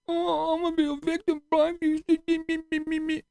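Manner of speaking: high, mocking whiny voice; mocking whiny victim. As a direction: sneer it like a self-pitying victim. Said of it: "played the little victim".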